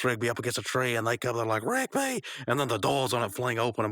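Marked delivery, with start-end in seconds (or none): 1.6-2.2 high-pitched shout of a name; high mock-frantic cry; alarmed calling. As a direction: call out to a friend in danger. Friends call out to trapped Rigby.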